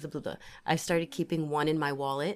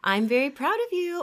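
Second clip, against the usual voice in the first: excited praise; 10 semitones higher, 6 dB louder than usual. Real, not acted. Praising co-host after long drive.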